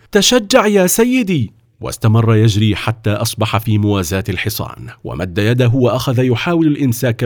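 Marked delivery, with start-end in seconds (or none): 0.1-1.5 high-pitched encouraging shout to a rider; raised, rallying cry; urgent call. A narrator's reading. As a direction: call out to urge someone on, high. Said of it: "وهتف به".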